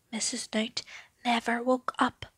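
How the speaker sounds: whispered name, barely there; sleeping-granny whisper; hushed whisper. A narrator's reading. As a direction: breathe it so she sleeps on. Not waking her, whispered.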